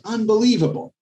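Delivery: exasperated disbelief. Retold or imitated. Proctor exasperated at one buyer.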